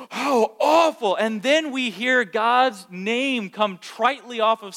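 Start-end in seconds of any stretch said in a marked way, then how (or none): 0.1-1.1 "How awful" slow, higher and louder; mock-appalled listener hearing someone swear; shocked disapproval. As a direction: play the scandalised bystander. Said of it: "and we go".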